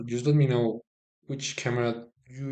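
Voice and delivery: ordinary talk, nothing marked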